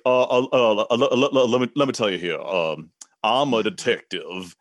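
hammed-up Southern accent, stumbling into the words; overdone U S Southern detective; Foghorn Leghorn. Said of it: "he's talking like Foghorn Leghorn".

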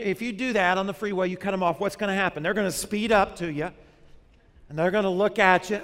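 ordinary talk, nothing marked

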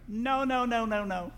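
high-pitched copycat no's; imitating a child. Daughter copying her father's scolding, high child voice. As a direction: echo the parent like a child. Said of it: "So she comes around".

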